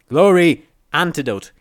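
loud, high call to rouse her; urgent shout. A narrator's reading. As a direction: shout to get through the daze. Rousing dazed companion urgently.

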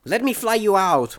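high-pitched female character calling out; urgent yell. A narrator's reading. Morning Glory offering rescue, urgent high yell. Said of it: "Morning Glory yelled".

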